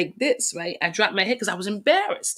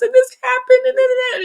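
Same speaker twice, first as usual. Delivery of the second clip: high, loud wide-eyed innocent voice; innocent mom; 5 dB louder than usual. Retold or imitated. Daughter imitating her innocent mom telling what happened, wide-eyed. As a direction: play the mom clueless and earnest. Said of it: "she's, like, innocent".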